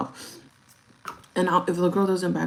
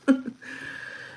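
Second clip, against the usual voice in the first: breathy laugh; 6 semitones higher, 4 dB quieter than usual. Real, not acted. Laugh line.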